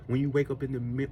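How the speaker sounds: usual speaking voice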